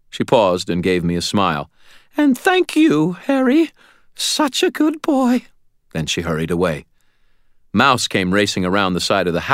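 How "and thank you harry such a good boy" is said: high, slowing, fond praise; fond landlady. A narrator's reading. Landlady smiling at injured tenant, high and fond. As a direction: praise him like a doting landlady. Landlady fondly thanks Harry.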